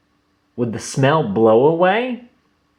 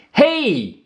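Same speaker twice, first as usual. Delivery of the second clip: high sharp call of a camper; yelled hey; 11 semitones higher than usual. A narrator's reading. Camper yelling at George to stop. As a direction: shout it like an angry camper. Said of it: "yelled the camper".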